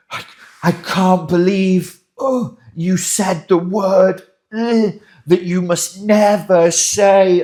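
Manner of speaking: high, loud, slow indignant gasp; mock outrage. High conflict person catching the partner saying a forbidden word, loud mock outrage. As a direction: act scandalised, savouring it.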